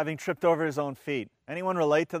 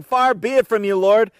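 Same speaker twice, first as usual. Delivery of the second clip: louder, forceful rebuke; rebuking; 4 semitones higher, 8 dB louder than usual. A narrator's reading. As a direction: push back hard, insisting. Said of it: "began to rebuke him".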